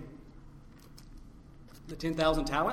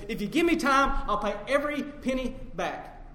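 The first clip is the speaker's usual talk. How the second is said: loud, high-pitched plea; begging servant; 7 semitones higher, 6 dB louder than usual. Acted. Debtor fallen before his master begging time, loud and pleading. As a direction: beg urgently for more time.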